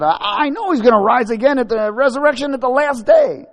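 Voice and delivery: high, loud, whining protest; exasperated Martha. Acted. Martha answering Jesus's promise, high exasperated protest. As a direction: push it high and loud, impatient to be heard. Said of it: "But Martha said".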